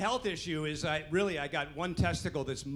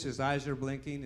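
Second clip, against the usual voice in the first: normal talking voice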